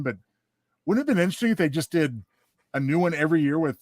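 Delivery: usual speaking voice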